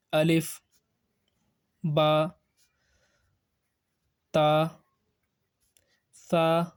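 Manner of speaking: Arabic letter names, Ba, Ta, Sa with open "aa" vowels; Arabic accent. Shown as a demonstration. Teacher models letters for pupils, Arabic accent. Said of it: "this is the Arabic accent".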